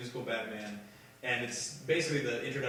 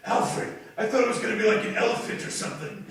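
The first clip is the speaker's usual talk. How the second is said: higher, louder, laughing through disappointment; dismayed surprise; 8 semitones higher, 6 dB louder than usual. Retold or imitated. Laughing surprised letdown. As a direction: Batman dismayed to find a kid, not an elephant. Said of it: "and he's like".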